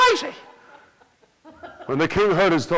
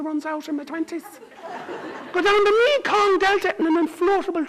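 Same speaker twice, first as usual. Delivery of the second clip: put-on high, breezy independent girl; high princess voice; 9 semitones higher, 3 dB quieter than usual. Princess listing her plans to the king in a high voice. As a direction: rattle it off high and carefree. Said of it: "And she said".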